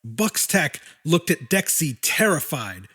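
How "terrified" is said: ordinary delivery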